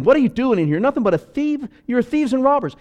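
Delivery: high, loud scolding as Jesus driving them out; angry rebuke. Acted. Jesus scolding the temple traders, loud and angry. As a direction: shout it at the money changers.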